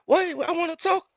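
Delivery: very high, whiny woman's voice; mock her begging at the bus stop; hammed-up high whiny woman; frantic girlfriend. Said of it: "She pulling up, just wilding".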